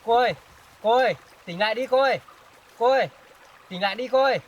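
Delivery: loud, high desperate calls; high shouted pleas to wake up; urgent pleading shout. Acted. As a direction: beg her to wake, shouting. Begging her to wake up.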